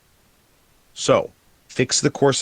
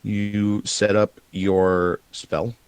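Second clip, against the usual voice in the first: usual speaking voice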